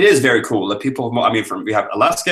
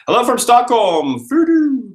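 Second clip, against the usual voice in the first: loud, high, gleeful hello; excited greeting; 6 dB louder than usual. Real, not acted. Greeting a viewer from Stockholm, loud and high.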